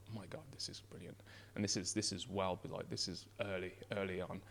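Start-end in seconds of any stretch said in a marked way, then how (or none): 0.1-1.2 breathy awed whisper. Retold or imitated. Tasting a brilliant beer for the first time, whispered in awe. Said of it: "I remember drinking and going".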